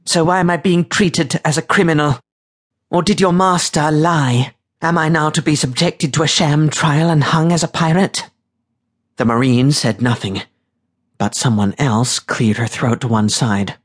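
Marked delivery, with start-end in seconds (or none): 0.1-8.3 high, haughty, clipped indignation; imperious lady protesting her treatment; Katerina, lofty. A narrator's reading. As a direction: demand answers in a lofty, high voice. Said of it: "Katerina kept her voice lofty".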